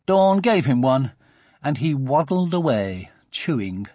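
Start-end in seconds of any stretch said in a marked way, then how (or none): none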